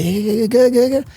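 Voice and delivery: high, excited mock cheers of clapping fans; mock cheering crowd. Guest mocking fans cheering a foreign name, excited mock cheer. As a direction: ham up the fawning crowd. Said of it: "brainwashed Nigerians who have been clapping and say".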